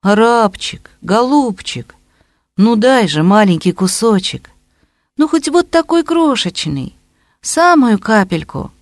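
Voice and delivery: high, pleading, abject whine; humble begging voice. A narrator's reading. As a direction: beg like a beggar. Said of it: "тот же униженный нищенский голос".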